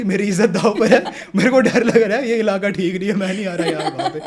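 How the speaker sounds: half-joking fright, laughing through it; laughing mock panic. Calling friend in mock panic.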